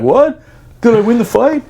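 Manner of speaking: high, bewildered questions; just woke up, no idea what happened; dazed and confused. Retold or imitated. Groggy marine waking to a flashlight, high bewildered questions. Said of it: "I'm like".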